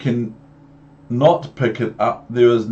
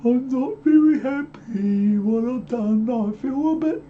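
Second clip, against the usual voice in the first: very high, sorry little cat voice; sad Cat in the Hat; 14 semitones higher, 4 dB quieter than usual. Acted. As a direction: squeak out the cat's regret. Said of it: "here's the Cat in the Hat going".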